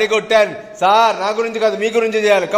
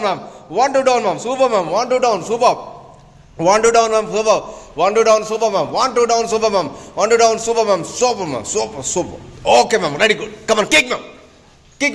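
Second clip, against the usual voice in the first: ordinary delivery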